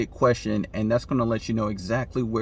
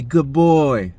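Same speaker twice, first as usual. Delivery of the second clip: louder, cheerful pet praise; praising a dog; 3 semitones higher, 8 dB louder than usual. Pretend. Owner praising the dog after the trick, upbeat. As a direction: reward him with your voice.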